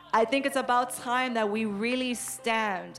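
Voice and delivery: forceful, measured declaration; rallying preaching. Real, not acted. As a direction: drive each phrase home to rouse the congregation.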